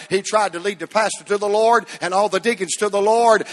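ordinary talk, nothing marked

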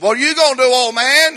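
put-on loud, high taunt at the old man; mocking call.

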